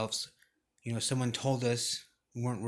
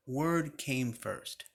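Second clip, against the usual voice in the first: slow emphasis; much slower than usual. Shown as a demonstration. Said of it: "repeating the key line".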